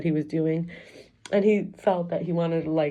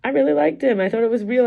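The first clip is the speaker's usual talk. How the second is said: higher and louder, near tears; tearful, voice rising; 6 semitones higher, 4 dB louder than usual. Real, not acted. Telling the camera about the man she misses, tearful. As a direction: let the hurt push the words up. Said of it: "Today I was crying about it".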